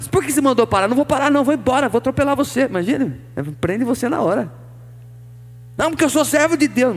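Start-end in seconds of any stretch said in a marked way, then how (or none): 0.1-2.7 fast, higher, louder defiant back-talk to a cop; mock disrespect. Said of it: "é um desrespeito". 5.8-6.9 mock pious excuse. Mocking the excuse at arrest.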